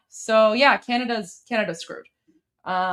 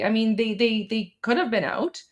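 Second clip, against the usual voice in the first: normal talking voice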